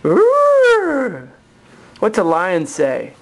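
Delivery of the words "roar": high loud trumpeting call; elephant trumpet. Shown as a demonstration. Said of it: "what's an elephant do".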